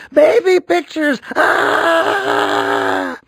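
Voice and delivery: high, loud crying shout; wailing shout. A narrator's reading. Boy shouting at the mall about the spit, wailing. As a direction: wail it like a mortified kid. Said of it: "yelled Andrew".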